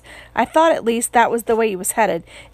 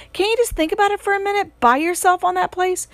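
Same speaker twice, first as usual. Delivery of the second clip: much higher, fast pleading; frantic higher plea to brother; desperate pleading; 8 semitones higher than usual. A narrator's reading. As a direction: rush to talk him out of it. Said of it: "I pleaded".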